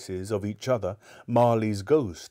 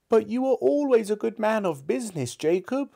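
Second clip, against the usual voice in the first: put-on high, wavering old miser's voice; faltering Scrooge; 13 semitones higher, 3 dB louder than usual. A narrator's reading. Scrooge falters to his dead partner's ghost, high and wavering. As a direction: falter it out as a frightened old man. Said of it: "faltered Scrooge".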